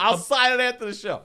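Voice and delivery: loud, high-pitched indignant holler; imitating a man yelling across a room; retold yelling. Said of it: "So he's yelling".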